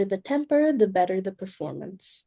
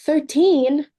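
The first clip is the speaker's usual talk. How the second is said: shocked; 5 semitones higher, 6 dB louder than usual. Acted. Hostess shocked at thirteen guests.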